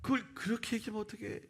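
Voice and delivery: high-pitched mock dismay; exasperated telling-off, pitched well up; shocked scolding. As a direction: act out an alarmed senior colleague scolding. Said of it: "그 대리님이 너무 놀라는 거야".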